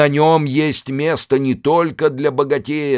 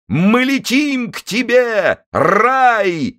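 high, slow jubilant shout; preacher exulting at liftoff; ecstatic; 11 semitones higher, much slower than usual. Acted. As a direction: stretch it out in rapture. Preacher's ecstatic launch cry.

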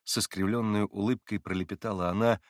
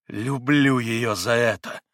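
slow, loud, twisted declaration; distorted, spiteful voice; 3 semitones higher, 7 dB louder, slower than usual. A narrator's reading. Katya speaks of Grushenka in a distorted, spiteful voice. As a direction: force it out with some savage spite. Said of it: "искажённым голосом прибавила Катя".

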